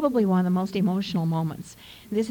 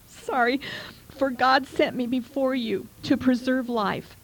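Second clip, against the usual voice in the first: sigh, then read on higher with emotion; choked up; 4 semitones higher than usual. Real, not acted. Preacher apologizing while reading Joseph's forgiveness, choked up. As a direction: overcome while reading aloud, pushing through. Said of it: "emotional just reading this".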